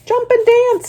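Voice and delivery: very high, loud rooster cry; the rooster calls to Wilbur, very high and loud; rooster calling. Acted. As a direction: crow it out. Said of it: "said the rooster".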